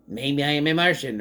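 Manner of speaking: a lightly put-on eerie Martian voice; hint of an otherworldly tone, only lightly voiced; mock alien voice. Mom in the movie teasing her son, lightly voiced as a Martian. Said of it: "She does the voice".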